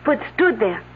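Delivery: put-on light French accent throughout. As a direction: speak English with a French lilt. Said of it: "unless someone's imitating her accent".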